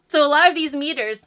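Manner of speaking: ordinary delivery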